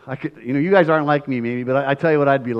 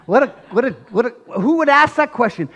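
Peter scoffing; 7 semitones higher, faster than usual. Acted. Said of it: "even Peter goes".

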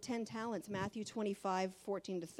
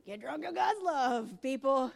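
louder, higher preaching call; fervent exhortation; 4 semitones higher, 8 dB louder than usual. Real, not acted. Preacher urging the congregation to love, at full force. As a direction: rouse the congregation. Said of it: "preaching climax".

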